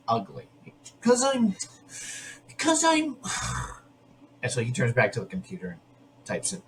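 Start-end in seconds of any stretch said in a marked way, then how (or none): 1.0-4.4 high-pitched, halting mock whine; mock girlish outburst. Imitating a girl admitting she feels ugly, high whiny outburst. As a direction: imitate a girl blurting out a sore confession. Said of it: "she basically lets out that she thinks she's".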